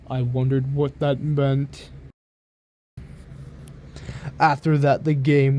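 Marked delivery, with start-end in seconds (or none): none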